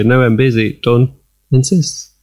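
normal talking voice

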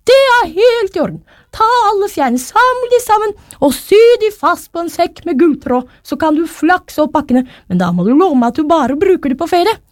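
high-pitched, agreeable character voice; Reidar's storybook voice. A narrator's reading. Reidar lets Thomas take the feathers, high agreeable voice. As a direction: grant the request in a high figure's voice.

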